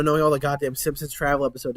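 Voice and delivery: usual speaking voice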